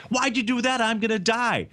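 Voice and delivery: high-pitched mock protest; dismayed frog. Stung frog protests in retold fable.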